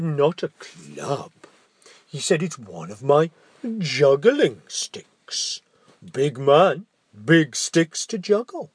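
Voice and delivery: slow, sly ogre. Acted. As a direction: slowly reassure like the ogre.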